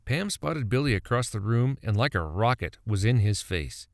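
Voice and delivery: ordinary delivery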